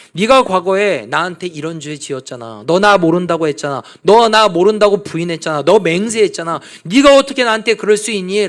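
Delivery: loud, rising, reproachful scolding; a Lord who rakes up Peter's past and scolds; accusing rebuke. Acted. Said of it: "과거를 들추면서 책망하지".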